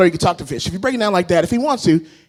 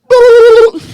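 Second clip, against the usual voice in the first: very high, loud hooting moan; a silly animal mating call; manatee moan; 13 semitones higher, 8 dB louder than usual. Retold or imitated. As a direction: make the call of a lovesick manatee. Manatee sex noise.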